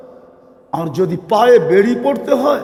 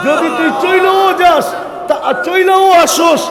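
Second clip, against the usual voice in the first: higher, louder preaching cry; preaching shout; 9 semitones higher, 5 dB louder than usual. Acted. Preacher shouting God's words to the crowd, loud and high. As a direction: cry it out to the congregation.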